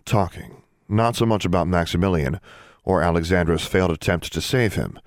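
ordinary delivery, nothing marked

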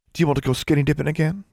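quick, high, teasing question; amused. A narrator's reading. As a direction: ask with amused curiosity, lilting upward. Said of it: "Julia asked, amused".

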